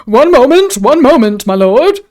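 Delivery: loud, high singing-out reply; harried innkeeper. A narrator's reading. Innkeeper placating a hungry lord, loud and eager.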